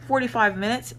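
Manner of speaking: normal talking voice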